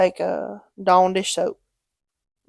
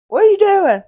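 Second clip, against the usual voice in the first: high, loud, sing-out phone greeting; imitated pestering dad; 12 semitones higher, 7 dB louder than usual. Dad phoning his kid at work, mock pestering. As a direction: call it out like a comic nagging dad.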